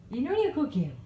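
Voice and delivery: high, playful question to the dog. Real, not acted. Owner asking her dog Luce if she's naughty, high baby talk. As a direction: tease the puppy sweetly.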